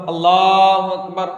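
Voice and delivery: lightly chanted Allahu Akbar; a brief imam-style call; takbir call. Shown as a demonstration. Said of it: "अब तकबीर बोलेंगे फिर इमाम साहब". (हल्की सुरीली तकबीर)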